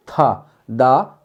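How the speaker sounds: था breathy with a puff, दा unaspirated, both very slow; aspiration demo. Shown as a demonstration. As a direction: say था, दा very slowly, with a puff on था. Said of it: "बहुत कम आयु, वायु आती है".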